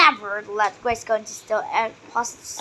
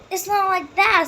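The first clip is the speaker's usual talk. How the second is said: higher, louder, pleading; desperate protest; 8 semitones higher, 5 dB louder than usual. A narrator's reading. Grace desperately pleading with her friends, higher and louder. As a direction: Grace begging the others to believe her. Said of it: "said Grace desperately".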